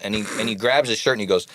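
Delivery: ordinary talk, nothing marked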